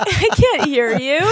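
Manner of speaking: acted laughing shout over noise; laughing, can't hear. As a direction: laugh helplessly while pretending not to hear. Acting out a loud bar.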